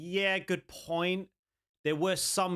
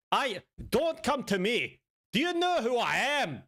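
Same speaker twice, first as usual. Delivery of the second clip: high-pitched, put-on Scottish accent; mock threat in a shaky attempt at Scottish; hammed-up Scottish hard man; bad Scottish accent; 7 semitones higher than usual. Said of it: "I know my Scottish accent isn't too good".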